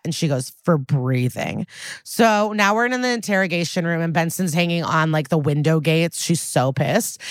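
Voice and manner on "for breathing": a lightly voiced, lower, slow and dry retort; understated low sneer; deadpan comeback. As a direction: drop it low and slow, mildly cutting. Benson's cold comeback to Finn.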